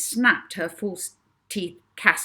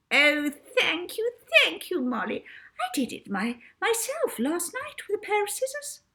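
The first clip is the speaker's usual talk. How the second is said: sugary matron; 13 semitones higher than usual. Acted. Miss Addiston thanking Molly for the compliment, high and sugary. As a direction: coo your thanks.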